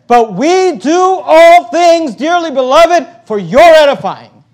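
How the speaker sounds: high, loud and drawn-out proclamation; forceful scripture reading. Acted. Preacher reading verse nineteen to church, loud and declamatory.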